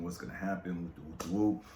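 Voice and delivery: ordinary talk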